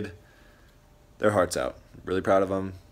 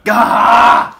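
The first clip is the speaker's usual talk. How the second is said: loud outburst of defeat; frustrated shout; 18 dB louder than usual. Real, not acted. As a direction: yell out in frustration. Loses rock paper scissors.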